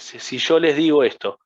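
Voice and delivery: ordinary talk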